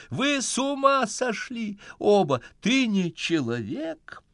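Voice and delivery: high, slow, laughing in disbelief; half-laughing, half-screaming it; laughing hysterically. A narrator's reading. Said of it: "начинает смеяться взахлёб".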